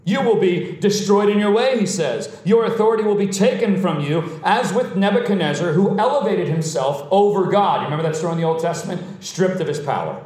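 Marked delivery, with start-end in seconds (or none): 0.1-7.8 forceful, emphatic sermon warning; impassioned preaching. Real, not acted. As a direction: pronounce judgment from the pulpit.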